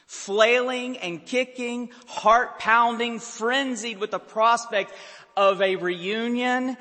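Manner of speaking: high, loud impassioned preacher; slowing on the frenzied images, preaching hard; fiery preaching. Acted. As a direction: build the congregation into a frenzy. Preaching at full force.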